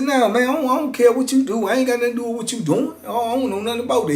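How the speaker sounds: high, fast, dismissive denial; quick denial. Retold or imitated. Teller waving off the inmate's question, fast and high. Retelling his own denial.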